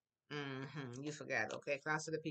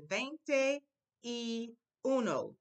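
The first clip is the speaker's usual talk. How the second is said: y uno drawn out apart from veinte; y uno, slow and spread out; slow separate words; 5 semitones higher, 5 dB louder, much slower than usual. Shown as a demonstration. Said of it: "I'll say it quickly".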